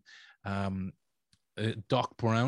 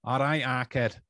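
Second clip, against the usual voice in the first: Manchester accent. Shown as a demonstration. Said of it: "I'll try and do the accent".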